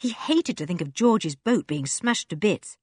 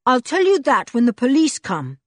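higher, louder bold retort; 6 semitones higher, 6 dB louder than usual. A narrator's reading. Julian defies Red, bold and louder. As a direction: stand your ground and fire it back. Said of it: "said Julian boldly".

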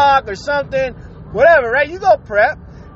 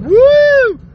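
a sudden high, loud whoop of joy; excited woo; 12 semitones higher, 6 dB louder than usual. Retold or imitated. Sunbather whooping at the sunny weather, excited. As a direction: cheer as if the sun is out for good. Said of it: "and you're thinking".

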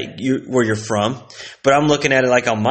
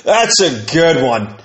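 laughing logger; much slower than usual. Retold or imitated. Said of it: "a guy logging logs would be like".

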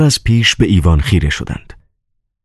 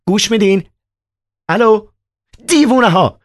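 mock phone shout. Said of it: "دوباره در گوشی فریاد زد".

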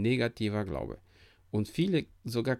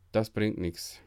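ordinary talk

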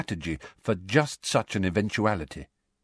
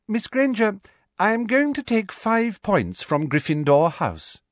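Umbridge voice; 12 semitones higher than usual. A narrator's reading.

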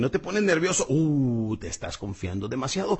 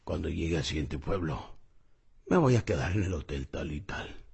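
grave y suave, contenido; señor del comercial; 9 semitones lower, 5 dB quieter than usual. Retold or imitated. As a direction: dilo bajito, en contraste con los anuncios. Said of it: "Se le queda viendo y dice". (imitación sobria del caminante ante la cámara)

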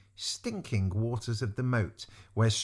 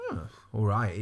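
usual speaking voice

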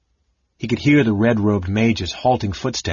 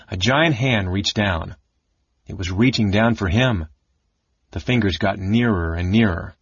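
ordinary delivery

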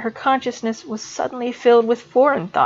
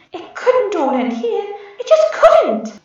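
much higher, slow despairing plea; despairing girl; 12 semitones higher, slower than usual. A narrator's reading. Student faces expulsion, rising despairing voice. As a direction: denial rising to a wail.

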